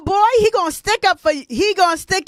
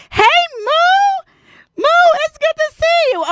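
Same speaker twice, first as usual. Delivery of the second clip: much higher, louder sing-song hello; delighted greeting; 5 dB louder than usual. Real, not acted. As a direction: greet a friend with big joy. Greeting viewer joining live.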